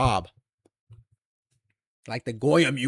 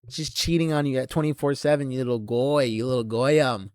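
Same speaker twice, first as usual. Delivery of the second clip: usual speaking voice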